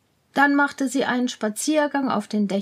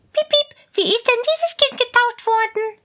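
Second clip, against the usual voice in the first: high, louder mouse voice; curious mouse; 12 semitones higher, 5 dB louder than usual. Acted. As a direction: squeak the question eagerly. Mouse asks.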